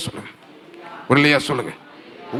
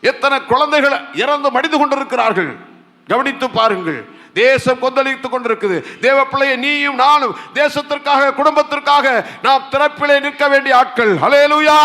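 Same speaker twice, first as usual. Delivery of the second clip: raised, forceful sermon voice; impassioned preaching; 7 semitones higher, 4 dB louder than usual. Real, not acted. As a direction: press the congregation with urgent appeals. (ஆவேசமான பிரசங்கக் குரல்)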